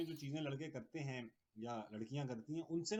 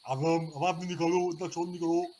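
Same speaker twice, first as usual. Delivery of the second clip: loud, stern order for silence; imitating a stern teacher shouting down the boys; teacher's loud scolding; 10 dB louder than usual. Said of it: "तो लड़कों को डांट भी रहे हैं".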